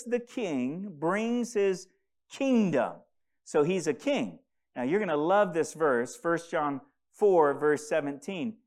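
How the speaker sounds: ordinary delivery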